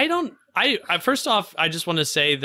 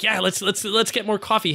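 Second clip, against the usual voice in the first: imitating a joyful guy, pitched up; lightly acted eager delight, higher voice; ecstatically joyful; 6 semitones higher than usual. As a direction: play a happy, eager man, mildly. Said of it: "ecstatically joyful guy".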